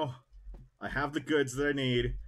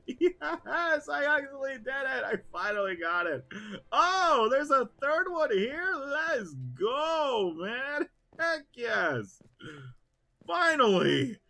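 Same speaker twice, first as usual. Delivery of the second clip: high, excited cheering; elated; 14 semitones higher, 4 dB louder, slower than usual. Real, not acted. Gamer cheering after finally succeeding, high and excited. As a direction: celebrate the win. Victory celebration.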